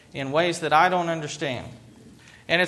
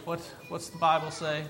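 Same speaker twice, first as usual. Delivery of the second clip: softer, quick self-questioning; inner thought; 9 dB quieter, faster than usual. Retold or imitated. Frustrated pastor quietly asks himself what to think. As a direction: mutter the question to yourself. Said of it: "I stopped and I thought".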